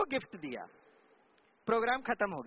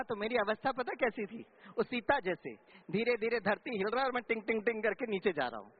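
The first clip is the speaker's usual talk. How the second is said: normal talking voice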